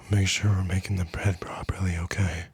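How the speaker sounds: whispered reminder right into the ear. Retold or imitated. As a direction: breathe it into someone's ear as a nag. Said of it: "comes up to your ear and goes".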